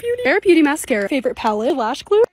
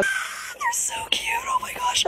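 much higher, soft whispered squeal; 10 dB quieter, much slower than usual. Real, not acted. Vlogger whispering over cute charms, high squeal. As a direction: barely breathe it out, overwhelmed by cuteness.

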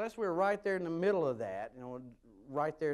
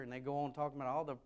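usual speaking voice

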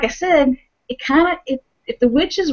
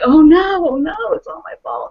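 high, loud "oh no" that falls into a mild wail; a touch of a guilty wail; mock distress; 9 semitones higher, 4 dB louder than usual. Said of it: "she's like".

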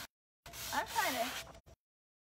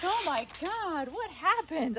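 mock alarmed mom; 5 semitones higher, 4 dB louder, slower than usual. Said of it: "She was like".

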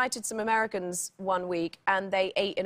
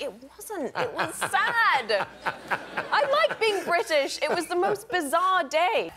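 laughing, higher and slow; mock-tragic about leaving Britain; mock dramatic; 7 semitones higher, slower than usual. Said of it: "And I was like".

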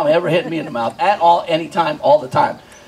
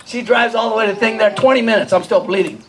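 normal talking voice

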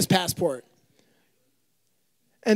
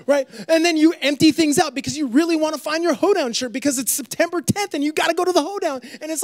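normal talking voice

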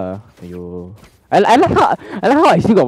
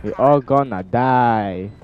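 mock doom; slower than usual. Doom joke in slow drawl.

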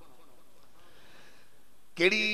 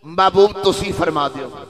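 louder, firmer restatement of معبود تسی فرما دیو; firm, louder sermon restatement; louder emphatic repeat; 2 semitones lower, 8 dB louder than usual. Shown as a demonstration. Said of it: "کل،".

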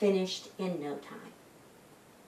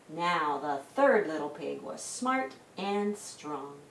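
usual speaking voice

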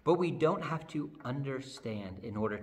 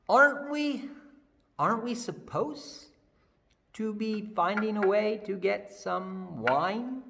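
confused servant; 6 semitones higher, 4 dB louder than usual. Acted.

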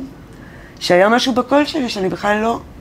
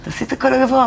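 higher, lightly voiced insistence from Arik; a hint of the producer brushing her off; quoting the producer; 7 semitones higher than usual. Arik insisting she sing the high part, lightly voiced. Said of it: "הוא אומר".

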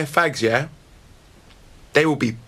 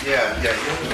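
normal talking voice

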